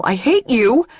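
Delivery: loud, high furious yell; loud, high shout of hate. Acted. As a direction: shout it as you hit him. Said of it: "I punch him and yell".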